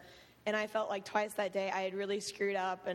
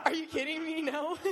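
laughing disbelief; 7 semitones higher than usual. Retold or imitated. Retells refusing counselor offer.

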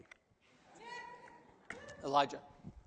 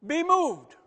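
loud, higher command; commanding decree; 10 semitones higher, 12 dB louder than usual. Retold or imitated. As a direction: order the mountain to move. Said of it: "you could say to a mountain".

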